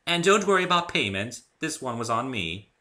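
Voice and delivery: high, loud generous pony lady; refined Rarity. A narrator's reading. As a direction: elegant unicorn reassuring. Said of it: "Rarity speaking".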